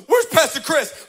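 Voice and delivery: high loud demand; demanding yell. Retold or imitated. Stranger storming into the office, loud demanding call. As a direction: burst in and demand him. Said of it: "storms her office and goes".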